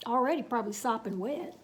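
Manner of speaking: ordinary delivery, nothing marked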